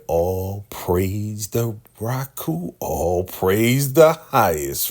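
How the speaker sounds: stretched, slow mock chant; slow drawn-out praise. [name] in lingerie mock-praising in a slow drawl. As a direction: draw each word out.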